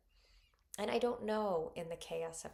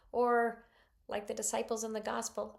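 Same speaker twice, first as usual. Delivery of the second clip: usual speaking voice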